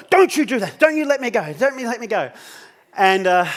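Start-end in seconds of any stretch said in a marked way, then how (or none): 0.1-2.3 panicked. Retold or imitated. Jan on the edge begging him, high and frightened. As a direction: plead with mock terror. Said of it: "it was".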